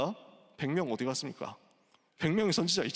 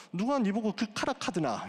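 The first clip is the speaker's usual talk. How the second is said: higher-pitched Busan dialect retort; 7 semitones higher than usual. Retold or imitated. God to Elijah as a Busan pastor tells it, in Busan dialect. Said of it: "부산말로".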